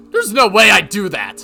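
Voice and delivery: loud, high protest; angry denial. Acted. Yoshimura accused of being the slasher, angrily denying. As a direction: deny it hotly.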